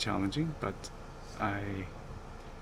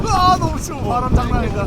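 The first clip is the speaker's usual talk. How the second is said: squealing about the cold; shivering in the cold; 12 semitones higher, 9 dB louder, much faster than usual. Real, not acted. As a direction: shout through the biting wind. Complaining about the freezing wind.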